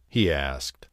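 ordinary delivery, nothing marked

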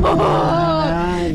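long, high, stretched-out amazement; stunned drawn-out curse. Real, not acted. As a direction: drag the word out in disbelief. Final answer revealed, stunned. (palavrão arrastado de espanto)

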